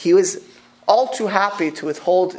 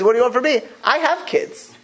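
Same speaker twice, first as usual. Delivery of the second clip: exasperated retort; 7 semitones higher than usual. Retold or imitated. Yaakov's exasperated reply to Rachel.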